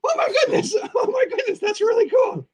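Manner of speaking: very high, squeaky creature giggling; Boogeyman puppet voice. Acted. Puppeteer correcting his slip of voice, very high giggly squeak. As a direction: redo it in a piping puppet falsetto. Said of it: "I started talking in my Dead Skeleton voice".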